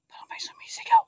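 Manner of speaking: hushed whisper so nothing hears; whispered fright at a distant scream. Real, not acted. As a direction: whisper it, afraid to be heard. Hears screaming in haunted place.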